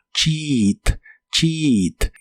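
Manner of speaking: "cheat" with EA as a long "ee"; teacher's model of "cheat" with a doubled I sound. Shown as a demonstration. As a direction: say "cheat" as "cheeeet", vowel held. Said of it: "una I larga o doble".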